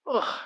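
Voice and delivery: pained grunt. Acted. Iron Man cut off by Hulk's headbutt, pained grunt. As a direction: grunt as if hit in the chest.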